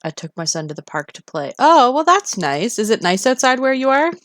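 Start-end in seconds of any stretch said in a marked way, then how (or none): 1.6-4.1 excited. Real, not acted. Reacting to son at the park.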